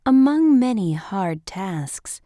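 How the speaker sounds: usual speaking voice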